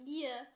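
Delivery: "Yeah" with an exaggerated Canadian Y glide; "Yeah" said as a drawn-out "yee-uh"; extra Y sounds. Shown as a demonstration. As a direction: stretch "Yeah" out from a strong Y. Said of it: "there were all these extra Ys".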